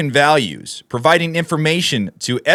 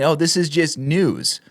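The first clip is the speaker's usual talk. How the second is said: mock news voice. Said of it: "They're just kind of displaying".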